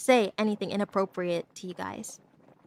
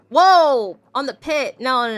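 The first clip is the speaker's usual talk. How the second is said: sudden loud startled whoa, higher; surprised whoa; 4 semitones higher, 12 dB louder, slower than usual. Real, not acted. Startled by the chat's mango joke, a loud higher whoa. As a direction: caught off guard and blurting it out. Streamer reacts to chat remark.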